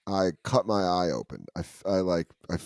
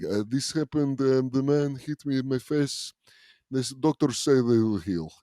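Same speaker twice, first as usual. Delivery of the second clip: halting fake Russian accent, slightly higher; hammed-up Russian accent telling a tall tale; 3 semitones higher than usual. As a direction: pose as a Russian fighter. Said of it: "doing a Russian accent".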